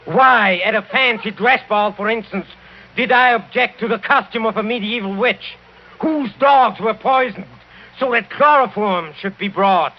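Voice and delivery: high, driving, pressing each question; heated accusation. Acted. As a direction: build the case, slowing on the last. Count heatedly lists evidence.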